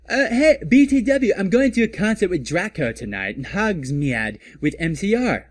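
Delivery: put-on high, loud valley girl voice; bubbly goth girl. Goth girl announces her concert plans to her friend, bubbly and high. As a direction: gush like an excited teen.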